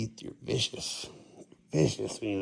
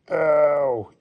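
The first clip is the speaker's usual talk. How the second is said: surprised; 4 semitones higher, 7 dB louder than usual. Real, not acted. Cat claws, loud exclaim.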